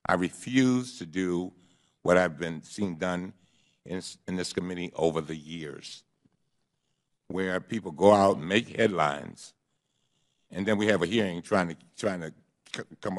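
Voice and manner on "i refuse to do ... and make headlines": slow, deliberate, word by word; over enunciating. Shown as a demonstration. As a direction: spell it out slowly. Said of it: "let me say it slowly".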